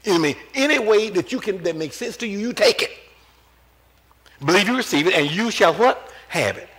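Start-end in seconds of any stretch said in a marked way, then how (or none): none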